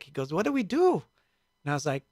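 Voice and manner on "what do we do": put-on very high German-accented question; high, pleading German accent. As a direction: imitate the German man, pitched way up. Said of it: "he had a German accent".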